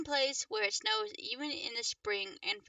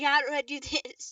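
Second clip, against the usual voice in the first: laughing; 4 dB louder than usual. Real, not acted. Struggles with the screen.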